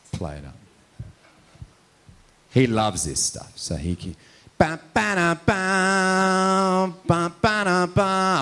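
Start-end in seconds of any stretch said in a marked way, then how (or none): 4.6-8.4 high sung ba-ba bass beat; high mock music beat; imitated car music. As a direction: mouth the thumping lowrider stereo. Imitating the lowrider's loud music.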